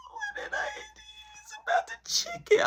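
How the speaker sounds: very high, whiny squeak; squeaky scared kid. Retold or imitated. Kid squeaks to his mom about the chicken, frightened.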